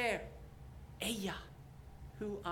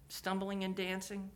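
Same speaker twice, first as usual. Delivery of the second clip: normal talking voice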